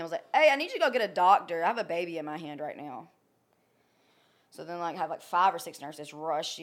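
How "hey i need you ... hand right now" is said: a sharp raised call that drops off; retold yell. Yelling to Will to fetch a doctor, loud and urgent. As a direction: shout for the doctor, then settle. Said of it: "And I yelled at him".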